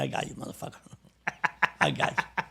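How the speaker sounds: whispered, laughing under the breath; gloating whisper. Retold or imitated. Valdez gloating to himself as the trap works, whispered. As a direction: whisper the gotcha to yourself. Gloating aside as crew covers.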